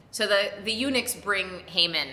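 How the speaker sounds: usual speaking voice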